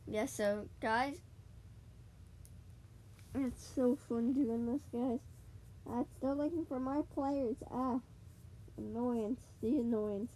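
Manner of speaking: ordinary talk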